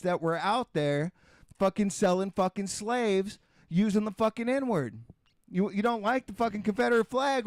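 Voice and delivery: real anger. Real, not acted. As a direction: push it out, fed up and angry. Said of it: "I know I sound angry".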